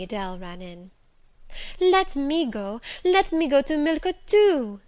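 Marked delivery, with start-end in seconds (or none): 1.8-4.8 put-on high, loud pleading little girl; a small girl begging to come along; eager child crying out. A narrator's reading. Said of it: "she cried".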